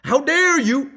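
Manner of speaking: mock blame rising to a loud, high shout; blaming, building to outrage. A hammed-up blamer turns on their partner, building to a shout. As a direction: start accusing, then explode on how dare you. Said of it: "As opposed to".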